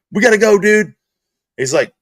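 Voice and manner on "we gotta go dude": urgent. Retold or imitated. Urgent plea after the injury.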